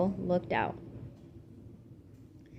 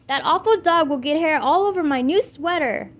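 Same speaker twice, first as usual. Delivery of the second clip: loud, higher wailing complaint; wailing over her sweater, loud and higher; 7 semitones higher, 7 dB louder than usual. A narrator's reading. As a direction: wail it out in distress. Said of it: "Jessie wailed".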